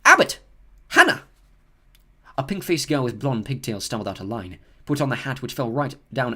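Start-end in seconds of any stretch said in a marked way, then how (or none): 0.0-1.2 loud, high name call. Acted. McGonagall calls a pupil forward, loud and high. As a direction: call the name across the hall. Reading names to the hall.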